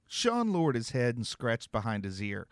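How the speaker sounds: ordinary delivery, nothing marked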